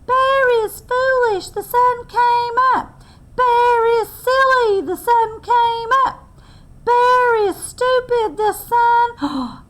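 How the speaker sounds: high, loud mocking squirrel taunt; teasing squirrel. Squirrel mocking Bear: high loud teasing chant. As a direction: taunt the bear in a squeaky sing-song. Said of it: "began to tease Bear".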